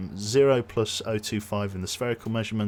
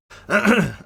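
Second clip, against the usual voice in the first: throat clear; 12 semitones higher, 13 dB louder than usual. Real, not acted. Clearing a failing voice.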